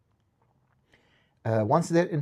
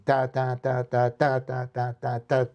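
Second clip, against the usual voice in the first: ta ta with the accent drifting; five against three sung as ta; polyrhythmic ta. Shown as a demonstration. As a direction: let the stress slide across the beats. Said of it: "five while playing three".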